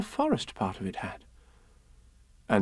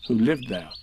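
ordinary delivery, nothing marked

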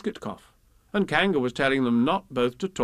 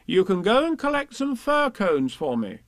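higher character voice; motherly Kanga; 8 semitones higher than usual. A narrator's reading. Kanga giving them a basket, high and motherly. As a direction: instruct the little ones in a raised voice.

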